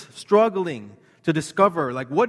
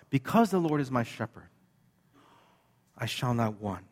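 "Because" leads with weight, the rest softer; stress on because; 4 semitones lower, 5 dB quieter than usual. Shown as a demonstration. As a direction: lean into "Because" to show the hidden link. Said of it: "implied ... is the word "because".

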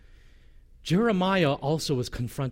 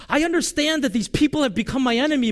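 much higher and louder, pressing; higher, louder complaint; impassioned plea; 10 semitones higher, 6 dB louder than usual. Retold or imitated. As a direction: cry it out to God. Jeremiah's honest complaint voiced.

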